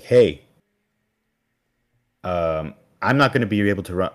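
loud, fast, barely held anger; furious dad. Retold or imitated. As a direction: spit it out like a dad about to snap. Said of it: "His rage is flaring up".

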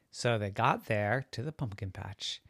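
ordinary delivery

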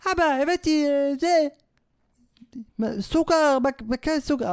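put-on high, hesitant child's voice; child to father. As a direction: play a kid haltingly showing dad a find. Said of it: "היית אומר לאבא שלך". (קול ילד גבוה ומהסס)

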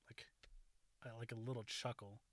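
ordinary talk

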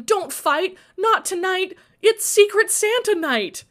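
high, loud pleading; urgent plea. A narrator's reading. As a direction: beg them loudly to stop. Pleading to stop a fight.

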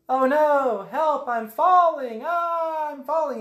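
high, drawn-out cries for help; hammed-up loud, high distress; mock falling panic. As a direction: wail theatrically as if falling. Voicing Obi-Wan falling down pit.

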